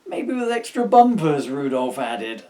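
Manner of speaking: Rudolph voice. Acted.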